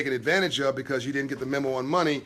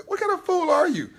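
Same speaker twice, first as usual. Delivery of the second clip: incredulous scoff; 14 semitones higher than usual. Real, not acted. Scoffing at merchants refusing Amex.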